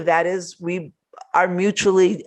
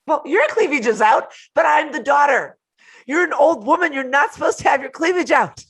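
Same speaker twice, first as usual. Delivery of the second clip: higher, louder sarcastic comeback; playful mock outrage, pitched up and louder; indignant mock retort; 9 semitones higher, 3 dB louder than usual. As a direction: fire back at the bossy daughter, half-yelling. Mom retorting to daughter.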